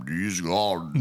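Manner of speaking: low, slow actor impression; Christopher Lee. Asked to redo the Loomis line, slow low impression. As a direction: draw it out deep and slow. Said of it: "Can you do a Christopher Lee?".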